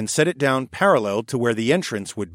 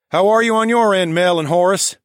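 louder, higher call across the frame; 8 semitones higher, 7 dB louder than usual. Acted. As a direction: call up to the others. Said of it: "the raccoon architect called".

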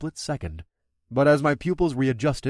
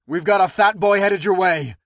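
louder, higher warning; warning shout; 6 semitones higher, 6 dB louder than usual. Acted. Rider warning her crew, shouting. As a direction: call the warning out.

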